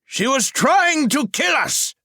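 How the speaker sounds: high, loud and slow furious retort. A narrator's reading. Merlin furiously answers Elan, loud and slow. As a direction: hurl it back in fury. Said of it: "Merlin was furious".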